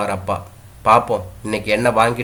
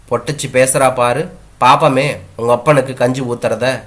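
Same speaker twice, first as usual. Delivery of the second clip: ordinary delivery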